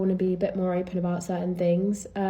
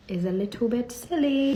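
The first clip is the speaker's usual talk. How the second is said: mock-delicate; 5 semitones higher than usual. Real, not acted. Pointed verdict on golfers' assumption.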